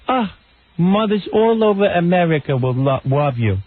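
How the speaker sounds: gushing high mock interviewer; Barbara Walters. Host mimics interviewer fawning over her guest, mock gushing. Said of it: "She goes".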